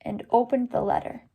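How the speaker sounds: expressive read-aloud storybook voice; story reading. A narrator's reading. Teacher reading the birthday book to students, expressive.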